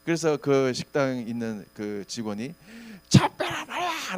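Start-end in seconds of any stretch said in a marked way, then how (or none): 3.1-4.2 high, drawn-out yelled order; 소리 지르는 직원. Retold or imitated. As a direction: imitate an irate worker hollering. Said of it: "얼마나 소리를 지르는지". (높고 길게 내지르는 호통)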